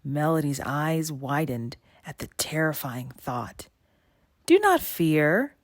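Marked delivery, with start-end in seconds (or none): 4.5-5.6 slow, louder, coaxing and higher; sly creature. A narrator's reading. Sea snake persisting with Melody, sly coaxing. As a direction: soothe her while you scheme. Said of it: "the sly creature persisted".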